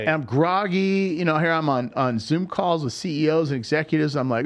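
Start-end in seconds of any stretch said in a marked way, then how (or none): none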